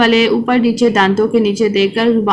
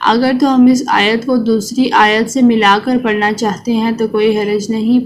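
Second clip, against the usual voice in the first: ordinary delivery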